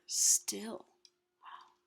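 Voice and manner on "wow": ordinary delivery, nothing marked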